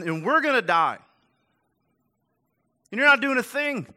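high, loud exasperated yelling; frustrated shout. Retold or imitated. Disciples yelling at sleeping Jesus, loud and frustrated. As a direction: yell at him like the boat is sinking. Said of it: "you can see the frustration in it".

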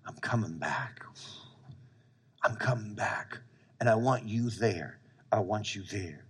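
Jesus softly calling Peter back, hushed and tender; tender quiet Jesus. Acted. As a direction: murmur it gently like a promise to a friend.

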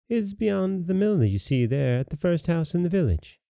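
put-on high, innocent storybook child; little girl voice. Little girl giving the wolf directions, high child voice. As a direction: pitch it up for a trusting little girl.

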